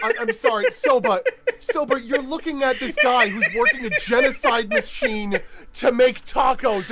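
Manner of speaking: loud, high, cracking up while talking; laughing. Real, not acted. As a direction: push the words out through real laughter. Helpless laughter while apologising.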